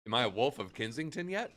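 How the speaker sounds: usual speaking voice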